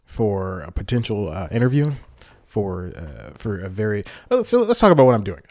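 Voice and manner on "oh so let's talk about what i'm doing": higher, louder, hurried burst; abrupt topic switch. Real, not acted. Vlogger interrupting his own ramble, high hurried announcement. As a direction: cut yourself off, quick and high.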